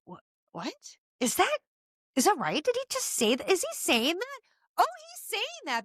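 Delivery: a world leader, earpiece in, reacting in disbelief to the speech; imitating a baffled listener. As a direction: play a stunned world leader hearing the translation. World leader hearing the translation.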